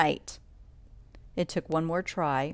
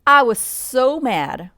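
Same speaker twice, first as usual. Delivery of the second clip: mad; 6 semitones higher, 9 dB louder, slower than usual. A narrator's reading. Narrator recalls anger in story.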